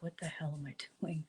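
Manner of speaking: very soft, quick aside to oneself; retold inner voice under the breath; muttered self-doubt. Nervous inner thought retold.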